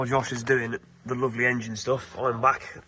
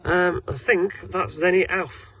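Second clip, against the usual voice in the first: ordinary talk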